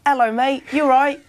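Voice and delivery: put-on high, loud English-accented greeting; exaggerated English accent. Actor proving his English accent to doubters, high and loud. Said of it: "with an English accent there".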